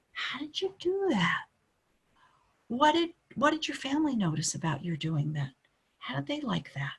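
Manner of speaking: curious, admiring questions; a tone of admiration. Shown as a demonstration. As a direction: ask with real admiration. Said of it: "with a tone of admiration".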